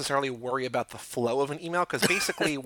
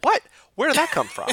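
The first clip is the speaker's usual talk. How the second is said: baffled boss; 13 semitones higher, 4 dB louder than usual. Retold or imitated. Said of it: "and he was just like".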